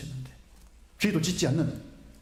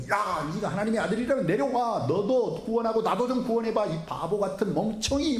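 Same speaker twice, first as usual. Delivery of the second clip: 조롱하는 강도; 8 semitones higher than usual. Retold or imitated. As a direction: sneer at Jesus like a scoffing criminal. 좌편 강도가 예수님을 욕함. (십자가 위에서 예수님을 비웃는 강도, 높고 큰 조롱)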